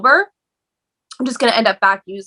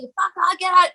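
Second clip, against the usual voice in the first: high mock yell at restrained volume; half-voiced drunken yelling; retold yelling; 9 semitones higher than usual. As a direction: imitate a belligerent yell, pitched way up. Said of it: "like, yelling".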